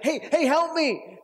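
high, drawn-out cry for help; calling for help. Acted. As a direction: call out urgently, mock desperate.

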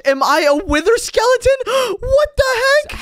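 loud, high-pitched astonishment; stunned, voice leaping up; shocked disbelief. Acted. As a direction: gasp and cry out in disbelief. Discovers he is a skeleton.

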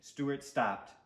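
ordinary delivery

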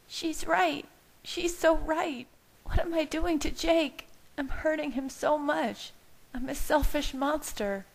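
high-pitched, distraught self-blame; near hysterical. A narrator's reading. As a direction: voice a woman on the edge of hysteria. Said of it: "her voice bordering on hysterical".

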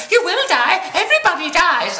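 put-on high-pitched indignant little kid; high, loud kid correcting a grown-up; mock child voice. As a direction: pipe up like an insistent seven-year-old. Seven-year-old children arguing back.

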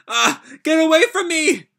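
high, loud frightened yell; high panicked shout; panicked cry. Acted. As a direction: scream it like you're cornered. Nataku panicking.

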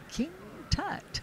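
King Tut pitched high for the punchline; spring King Tut on them as a surprise; playful reveal. Real, not acted. Actress telling the interviewer who kidnapped her, teasing reveal.

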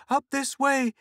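high, loud panicked cry; frantic cry. A narrator's reading. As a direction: cry out in sudden terror. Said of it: "she cried".

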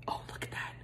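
hushed little whisper. Real, not acted. Whispering in awe at the lip stain. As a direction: breathe it out in awe.

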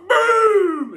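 very high, loud shouted Boom. Retold or imitated. Winding up Nat on the show, he yells his catchphrase. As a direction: bellow the catchphrase. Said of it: "it's like".

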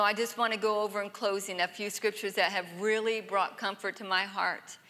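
usual speaking voice

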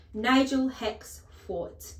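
loud, high, drawn-out challenge; outraged headmistress's roaring question; bellowed demand. A narrator's reading. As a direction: bellow it slowly at the boy. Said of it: "the Trunchbull bellowed".

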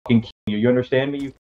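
low-pitched role-played menace; menacing threat. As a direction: threaten them in a low, controlled voice. Role-played threat over stolen knives.

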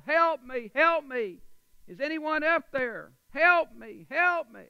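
high, loud cry for help; calling for help. Acted. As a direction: shout up the cliff for someone to save you. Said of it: "He's calling out".

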